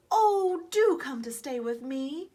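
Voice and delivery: high, loud delighted welcome; eager invitation. A narrator's reading. Squirrel inviting the toy soldiers, loud and delighted. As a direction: call out warmly to the soldiers. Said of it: "invited Miss Susie".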